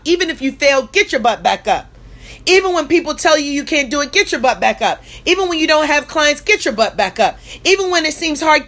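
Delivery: punchy refrain, each one driven home; booming motivational speech. Acted. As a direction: hammer the refrain like a preacher.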